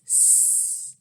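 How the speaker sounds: long soft hissing snake. A narrator's reading. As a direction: hiss like a snake. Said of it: "The snake says".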